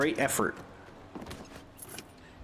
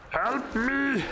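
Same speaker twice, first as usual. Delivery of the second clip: shrill cry for help; high panicked cry; 8 semitones higher than usual. Real, not acted. Boss attacking.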